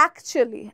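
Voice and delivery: actually clipped to "akchli", middle swallowed, low and soft; actually said native-style, "akchli", dropped quiet; correct pronunciation. Shown as a demonstration. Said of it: "গিলে ফেলতে পারি".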